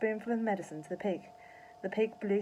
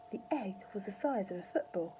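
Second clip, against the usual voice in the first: ordinary delivery, nothing marked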